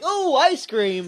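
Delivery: high, drawn-out oohing over a treat; mock delight. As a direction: imitate slow, high-pitched delight. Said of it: "hoping Brian will be like".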